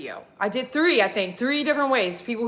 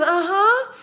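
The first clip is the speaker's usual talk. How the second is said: lightly voiced lady; 8 semitones higher than usual. Voicing lady.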